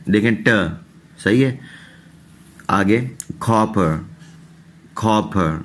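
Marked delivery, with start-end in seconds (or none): 3.4-5.6 کاپر with a short "uh" ending; teacher repeating کاپر; English word demo. Shown as a demonstration. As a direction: model کاپر carefully. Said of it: "یہاں پہ بھی پر کی آواز ہے".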